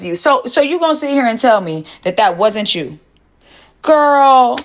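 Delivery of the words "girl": loud call-out. Real, not acted. To a viewer arguing in chat, a loud call-out. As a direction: call her out, louder and higher.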